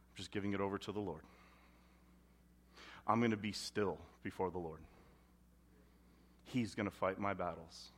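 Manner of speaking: 1 mock pious phrases.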